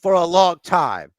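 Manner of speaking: loud, high, stammering build into yelling; mock venting outburst. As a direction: act out pent-up words exploding into an attack. Acting Kaiser venting, then attacking.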